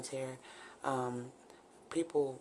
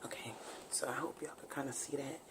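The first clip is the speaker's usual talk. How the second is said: whispering; 14 dB quieter than usual. Real, not acted. Turned away, baby sleeping.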